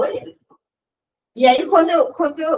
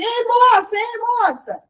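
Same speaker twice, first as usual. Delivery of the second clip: calling out; 10 semitones higher, slower than usual. Retold or imitated. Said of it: "eu ouço assim".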